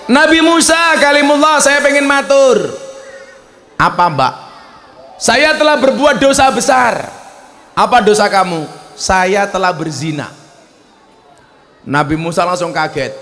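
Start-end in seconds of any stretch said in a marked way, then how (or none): 0.1-10.3 high, pleading woman's voice. Acted. Woman confessing her sin to Musa, high and pleading.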